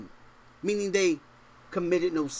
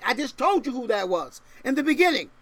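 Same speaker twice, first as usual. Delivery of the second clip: ordinary talk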